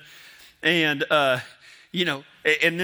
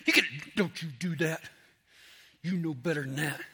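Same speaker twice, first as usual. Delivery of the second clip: coaxing the horse; 3 dB quieter than usual. Retold or imitated. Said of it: "you're talking to your horse".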